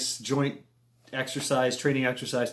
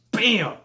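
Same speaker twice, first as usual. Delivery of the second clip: punchy, higher "bam!"; sudden slap noise; 7 semitones higher than usual. Retold or imitated. Showing how mosquitoes bite, a sudden imitated bam.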